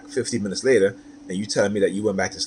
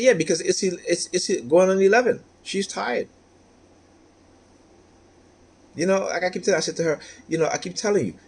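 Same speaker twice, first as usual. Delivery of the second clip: higher, heated, lecturing the ex; raised voice; 5 semitones higher than usual. Retold or imitated. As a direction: raise your voice to make the point. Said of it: "I had to raise my voice a little bit".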